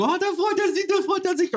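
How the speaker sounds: put-on very high, sing-song baby talk; cooing dog owner. Owner cooing at the dog in very high baby talk. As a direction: coo it like a doting owner who misreads the dog.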